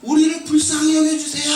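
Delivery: acted distant shout, pitched well up; pleading shout. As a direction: cry out for mercy across a distance. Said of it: "이렇게 소리 질렀어요".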